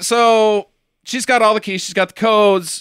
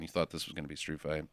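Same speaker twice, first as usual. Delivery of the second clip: softer and fast, tossed off under the breath; quick quiet requote; 14 semitones lower, 18 dB quieter, much faster than usual. Retold or imitated. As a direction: rattle it off quietly to yourself. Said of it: "I did like that line".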